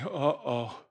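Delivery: a hint of dread; mild worried uh-oh. Son seeing his father run, mildly worried.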